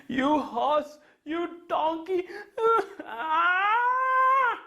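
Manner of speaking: high angry scolding then cry; indignant prince. Acted. Muddy prince scolding the horse and donkey, high indignant cry. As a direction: scold the beasts in a huff.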